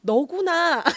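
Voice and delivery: high, loud, halting exclamation; stall, then blurt it out awkwardly; imitating a stumped baby visitor; awkwardly lost for words. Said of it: "뒷말을 잊지 못하였다고".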